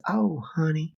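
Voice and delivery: high, slow honeyed Southern grandma voice; a sweet Southern grandma setting a young activist straight; sweet Southern granny. Retold or imitated. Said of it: "in her best sweet Southern granny voice".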